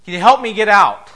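high, loud call for help; shouting up from a hole. Acted. Man in the hole shouting up to the doctor, loud and high. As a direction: yell up to the doctor above. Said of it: "The guy from below shouts".

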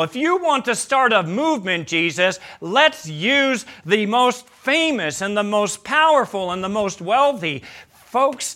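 high, emphatic preacher's voice; impassioned preaching. Acted. Preacher voicing the charge against Jesus to his congregation, impassioned. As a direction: drive each charge home, punching the key words.

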